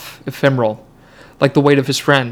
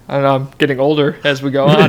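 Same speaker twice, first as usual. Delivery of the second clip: usual speaking voice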